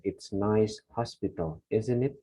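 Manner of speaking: "It's nice hospital, isn't it?" read very slowly, every word clear; slow and precise. Shown as a demonstration. Teacher reading slowly to show accuracy matters over speed. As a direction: take it slow and exact, not fast. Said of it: "tidak harus cepat ... tetapi tepat".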